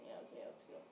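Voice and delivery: whispered aside; whispering. Real, not acted. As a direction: breathe it out unvoiced.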